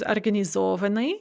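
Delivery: организованы stressed on the syllable зо; shifted stress. Shown as a demonstration. Teacher reviewing the stress shift, зо stressed in организованы. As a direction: move the stress of организованы back onto зо. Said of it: "shift one syllable to the front".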